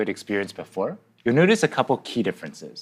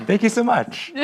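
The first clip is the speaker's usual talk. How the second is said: laughing; 12 semitones higher than usual. Real, not acted. Laughing thanks.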